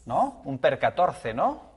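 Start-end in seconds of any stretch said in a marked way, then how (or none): none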